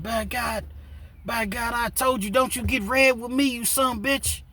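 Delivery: high, strained backwoods threat; angry Kentucky backwoods voice. Retold or imitated. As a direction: snap like a riled hillbilly ready to fight. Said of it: "Sean finally jumps up and said".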